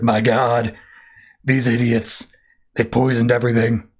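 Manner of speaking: a hint of a disgusted Aquaman; Aquaman's disgusted voice. Aquaman seeing the dead dolphins, lightly voiced disgust. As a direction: mutter it like a sea king in disgust. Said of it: "he's like".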